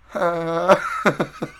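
loud, high mock laugh stopped midway; imitated cut-off laugh. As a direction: act out being caught mid-laugh. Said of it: "like, mid-laugh".

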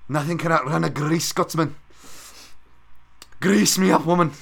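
a bad, belted Scottish janitor brogue; Groundskeeper Willie. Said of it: "these fucking terrible accents and Simpsons quotes".

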